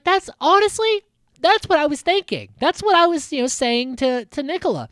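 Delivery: high, loud gushing imitation of her; mock eager agreement. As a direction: gush like she's finally been understood. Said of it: "And she's like".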